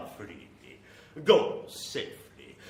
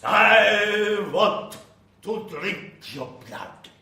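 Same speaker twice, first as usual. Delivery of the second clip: vampire threat; 7 semitones higher, 8 dB louder, slower than usual. Acted.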